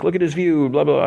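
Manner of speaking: high-pitched mimicry of someone gushing; mock gushing. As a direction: gush up high, then brush it off. Retold friend gushing over rooftop view.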